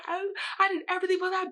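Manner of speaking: high-pitched mock scream; retold screaming. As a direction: wail it fast and high, imitating your own outburst. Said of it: "I was literally screaming".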